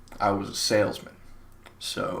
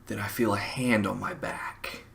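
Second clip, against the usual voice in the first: ordinary delivery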